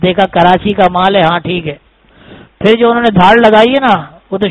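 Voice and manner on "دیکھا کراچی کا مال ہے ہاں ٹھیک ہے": relaxed lion. Acted. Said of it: "وہ شیر صاحب بھی ایسے کھڑے ہوئے آرام سے".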